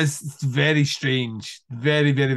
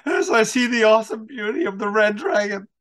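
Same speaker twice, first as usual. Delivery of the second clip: higher, eerie, reverent villain voice; grandiose villain; 7 semitones higher than usual. Retold or imitated. Quoting the Red Dragon killer, eerie grandeur. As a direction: proclaim it like a possessed killer.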